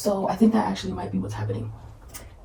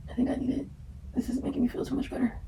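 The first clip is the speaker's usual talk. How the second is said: whisper; 4 semitones higher, 10 dB quieter, much faster than usual. Real, not acted.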